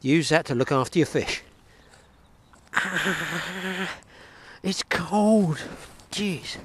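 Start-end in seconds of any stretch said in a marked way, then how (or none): 2.7-6.6 sharp yelp at the cold; surprised. Real, not acted. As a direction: gasp it out at icy water. Hand in cold water.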